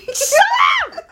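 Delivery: shrieked high and loud; high shout. Real, not acted. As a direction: yell it at a friend. Friend mocks her.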